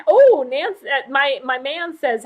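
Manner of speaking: normal talking voice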